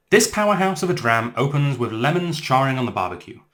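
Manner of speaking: bright, lifted presenter read of the tasting notes. Acted. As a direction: read it like a lush whisky label, savouring each note. Reads the tasting notes aloud.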